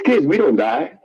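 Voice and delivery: ordinary talk, nothing marked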